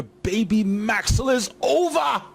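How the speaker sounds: slow, high wrestling proclamation, building to OVER; hammed-up high announcement; hyped announcer shout. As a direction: proclaim it like a ring announcer, stretching the last word.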